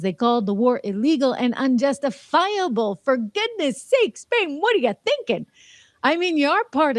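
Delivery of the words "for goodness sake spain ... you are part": exasperated. Pretend. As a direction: plead with Spain in disbelief. Exasperated appeal to Spain.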